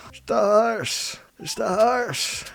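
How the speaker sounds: high and drawn out, slow; slow high doting voice. Acted. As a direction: savor each word up high. Dotes on the horse.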